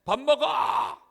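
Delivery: loud, high, stretched wake-up shout; acted parental nagging at full volume; nagging yell. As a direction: yell like a parent calling a child to breakfast. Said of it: "잔소리 속에 깨요".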